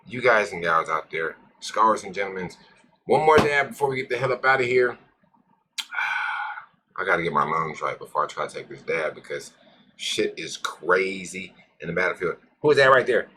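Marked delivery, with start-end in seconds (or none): none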